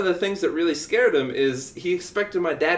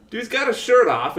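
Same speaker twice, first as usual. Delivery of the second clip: normal talking voice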